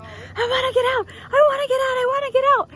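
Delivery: high, loud mock panic; imitated freak-out. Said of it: "freaking out. He's like".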